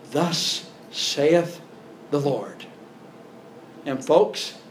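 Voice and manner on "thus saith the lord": preacher's solemn tone. Retold or imitated. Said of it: "They say it in that tone".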